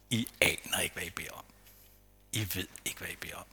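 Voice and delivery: low whispered words of Jesus; a soft, whispered correction to the disciples; hushed rebuke. Acted.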